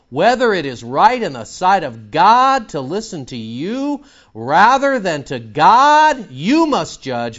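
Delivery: loud, slow scripture proclamation; preaching scripture. Acted. Preacher proclaiming Peter's answer to the council, loud and slow. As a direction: proclaim each word slowly and loudly. Reading scripture at full force.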